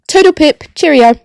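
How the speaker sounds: signing off to listeners in a mock posh British voice; mock posh goodbye. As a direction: toss off a jokey upper-class British bye. Jokey posh farewell.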